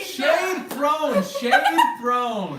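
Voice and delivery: loud, high, laughing call-out; laughing heckle. Real, not acted. Host heckles friends after a jab, laughing shout.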